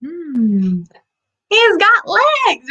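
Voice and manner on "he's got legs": high, slow eager reveal; excited reveal. Acted. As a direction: draw it out like a big discovery. Revealing answer to kids.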